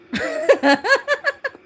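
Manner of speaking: big high laugh, loud and bright; high burst of laughter. Real, not acted. Laughing at advice never to outshout a man, loud high laugh.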